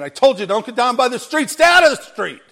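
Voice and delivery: high, loud parental telling-off; acted exasperated father yelling a warning; scolding dad. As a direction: scold like a dad, rising to a shout. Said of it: "It's like your dad saying".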